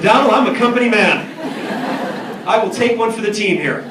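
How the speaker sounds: loud laughing reply; laughing loudly. Retold or imitated. Retelling his reply to Donald.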